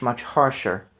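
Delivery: ordinary delivery, nothing marked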